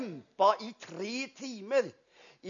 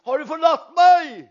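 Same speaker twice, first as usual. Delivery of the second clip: quoting Jesus's cry; 6 semitones higher, 7 dB louder than usual. Retold or imitated. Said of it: "men nå sa han".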